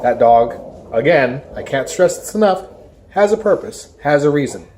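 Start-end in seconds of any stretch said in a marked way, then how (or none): none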